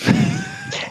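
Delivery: short high-pitched laugh; quick high giggle; real laugh. Real, not acted. Amused that you could survive.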